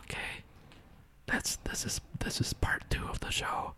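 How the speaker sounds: put-on hushed Mexican-accented host; creepy Mexican whisper. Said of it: "creepy Mexican whisper boy".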